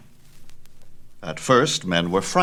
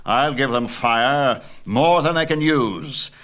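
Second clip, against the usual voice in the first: Zeus in rage; 5 semitones higher, 4 dB louder than usual. Acted. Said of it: "Zeus was full of rage".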